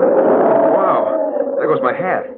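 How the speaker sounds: startled shout. Acted. Hat blown off in gale.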